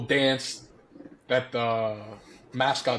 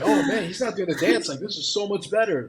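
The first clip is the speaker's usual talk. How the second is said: mock excited crowd; 7 semitones higher than usual. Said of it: "the crowd was like".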